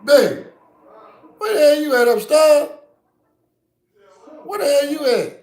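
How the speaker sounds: loud high call up the stairs. Retold or imitated. Calling to babe upstairs, loud. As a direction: call across the house.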